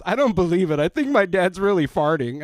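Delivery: put-on kid blurting loudly. Son loudly telling the store his dad is farting. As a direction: blurt it out to the whole aisle. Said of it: "he goes".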